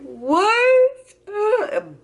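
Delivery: high, loud wail of disbelief; reenacted shriek breaking toward crying; shocked. Patient hearing she is pregnant, shocked.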